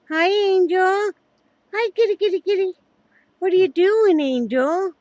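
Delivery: talking to a dog. Real, not acted. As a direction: call to the pets sweetly. Calling and cooing at pets.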